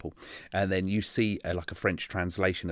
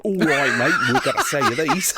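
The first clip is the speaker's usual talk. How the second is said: loud, higher mock British geezer; thick British accent; 5 semitones higher, 6 dB louder than usual. Dubbed advert actor greets viewers, exaggerated thick British accent. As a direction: belt out a hammed-up British accent. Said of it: "they'll have this thick British accent".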